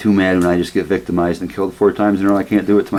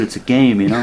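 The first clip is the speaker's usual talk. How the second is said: normal talking voice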